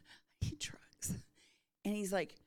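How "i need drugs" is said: slow, feeble whisper; weak, out of breath. Retold or imitated. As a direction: whisper it as if too drained to speak. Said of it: "I'm barely speaking".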